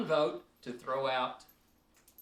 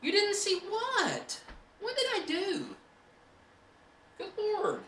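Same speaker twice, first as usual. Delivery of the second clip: melodramatic shock; 3 dB louder than usual. Pretend. Mock outrage at chat's comment.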